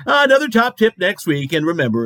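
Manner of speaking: ordinary talk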